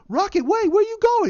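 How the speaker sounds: high, loud mock panic; Banner. Banner calling after fleeing Rocket, imitated high panic. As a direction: call out in alarm, voice shooting way up. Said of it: "you have him go".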